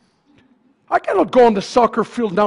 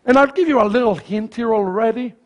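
ordinary talk, nothing marked

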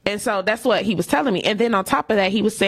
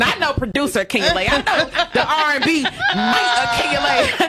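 higher and giggling, teasing tone; laughing; 6 semitones higher than usual. Real, not acted. Teasing friends about knowing the R and B [name], laughing. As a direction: laugh it out playfully.

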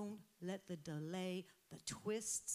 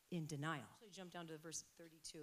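ordinary delivery

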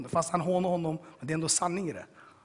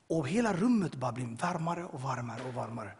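ordinary talk, nothing marked

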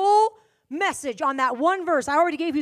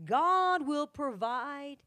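lower, softer, slow telling of Abraham and Isaac; soft scripture storytelling; 4 semitones lower, 5 dB quieter, slower than usual. Acted. Preacher telling the story of Abraham and Isaac, softer. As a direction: draw the listeners in gently.

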